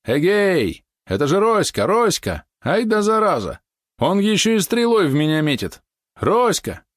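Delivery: high, loud shouting across water; кричит издалека; calling out. A narrator's reading. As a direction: hail him from the other boat. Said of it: "закричал".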